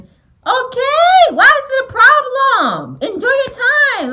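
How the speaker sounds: exasperated plea, high and loud. Real, not acted. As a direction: drag it out in disbelief. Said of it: "I'm getting irritated already".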